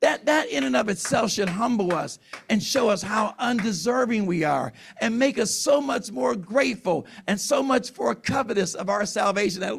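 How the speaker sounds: ordinary talk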